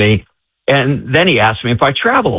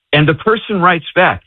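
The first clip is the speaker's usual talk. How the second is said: ordinary talk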